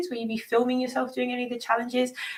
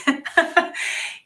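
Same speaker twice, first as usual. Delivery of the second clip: laugh; 9 semitones higher than usual. Real, not acted. Reading a funny viewer comment.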